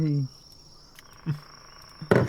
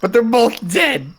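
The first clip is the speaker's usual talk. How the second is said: emphatic in-character protest; 8 semitones higher, 5 dB louder than usual. Acted. In-character protest, high and loud.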